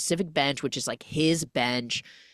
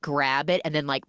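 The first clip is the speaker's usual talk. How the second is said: ordinary talk, nothing marked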